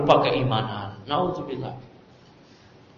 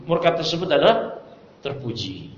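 normal talking voice

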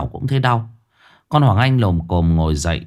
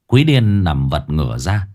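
plain reading voice

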